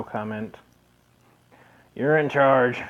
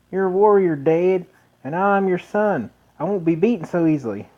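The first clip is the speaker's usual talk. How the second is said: higher, louder, lightly voiced proud young son; earnest son; 6 semitones higher, 4 dB louder than usual. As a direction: play a son pleading to fight on. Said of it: "I'm stuck in redneck mode".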